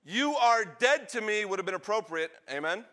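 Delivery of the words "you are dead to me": high, loud, slow denunciation; angry father. Acted. Father cutting off his son, angry. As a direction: pronounce it slowly and loudly, outraged.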